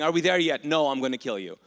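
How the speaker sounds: put-on loud bickering kids and annoyed driver; hammed-up road trip squabble; mock road trip exasperation. Moses and the travellers, loud mock annoyance.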